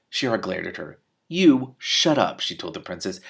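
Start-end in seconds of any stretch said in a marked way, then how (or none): 1.3-2.4 slow, firm command; stern order. Acted. Shiara silencing the princess, slow firm command. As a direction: lay down each word hard.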